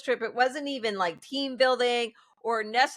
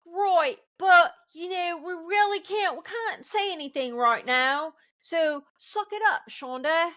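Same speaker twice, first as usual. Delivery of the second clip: mock dismissive bosses; 6 semitones higher than usual. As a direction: parody the bosses telling her to suck it up. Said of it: "They didn't really say that".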